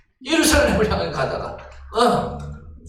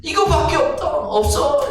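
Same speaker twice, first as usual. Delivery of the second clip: high, loud, surging sermon voice; fiery preaching; 8 semitones higher, 4 dB louder, slower than usual. Acted. Pastor building the congregation to fervor, fiery preaching. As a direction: preach it with everything, rising.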